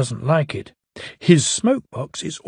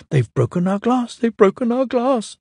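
mild mock sob, a little higher; sobbing; 9 semitones higher than usual. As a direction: wail it lightly like upset coaches. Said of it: "sobbed the coaches".

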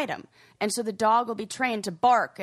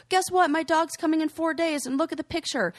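higher-pitched eager announcement; excited kid; 7 semitones higher than usual. Retold or imitated. Girl showing neighborhood kids her new dog's picture, excited. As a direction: voice a child bursting with news. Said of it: "she'd be like".

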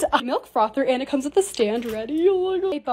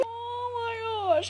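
near tears; 9 semitones higher, 10 dB quieter, much slower than usual. Real, not acted. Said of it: "I'm gonna cry".

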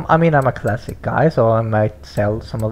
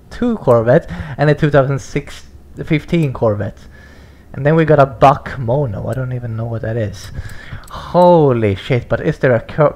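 ordinary delivery

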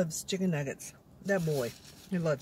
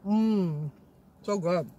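talking with mouth full; mumbling around food; chewing; much slower than usual. Real, not acted. Seen 0: cheeks puffed, lips pursed chewing burrito bite while murmuring.

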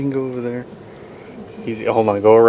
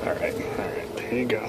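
soft whispered coaching; whispering; 14 dB quieter than usual. Real, not acted. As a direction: whisper the directions. Coaching the car round quietly.

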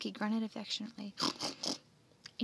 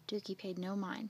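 plain reading voice

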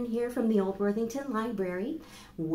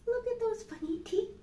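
much higher, playful delight. Acted. Librarian shows the children the bunny's teeth, delighted. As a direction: point out the funny teeth with glee. Showing the picture to kids.

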